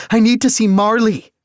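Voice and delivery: very high, loud desperate shout. Acted. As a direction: cry out for her. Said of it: "I called out".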